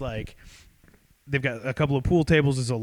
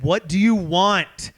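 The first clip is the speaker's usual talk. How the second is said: slow, raised, exasperated demand; louder, higher acted-out irritation; imitated furious girlfriend; 5 semitones higher, 5 dB louder, slower than usual. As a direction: space the words out in mock annoyance. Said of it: "she's furious".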